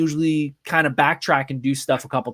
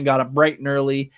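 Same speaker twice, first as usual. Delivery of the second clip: ordinary talk, nothing marked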